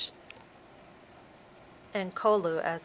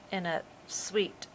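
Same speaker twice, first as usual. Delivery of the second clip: ordinary delivery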